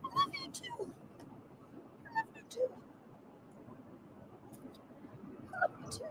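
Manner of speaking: tiny, very high, soft little voice. Shown as a demonstration. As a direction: squeak it back like a tiny shoulder. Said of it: "a little voice after".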